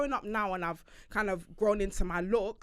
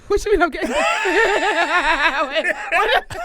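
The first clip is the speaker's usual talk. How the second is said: high, loud, fast, laughing protest; laughing disbelief; 7 semitones higher, 9 dB louder than usual. Real, not acted. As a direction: laugh out the question in shock. Teased by co-host, laughing.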